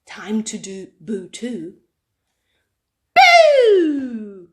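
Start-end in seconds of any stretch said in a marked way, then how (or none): 3.1-4.5 loud shouted boo; cheeky scare shout. Acted. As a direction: jump out and yell boo. Cub scares puffins.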